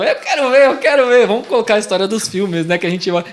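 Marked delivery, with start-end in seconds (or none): 0.0-1.3 whiny curious woman. Retold or imitated. Curious wife begging to look.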